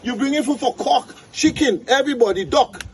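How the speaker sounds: high-pitched pig tirade, piling up the accusations; berating pig. Acted. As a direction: rail at the donkey in a high pig voice. Pig berates donkey in tale.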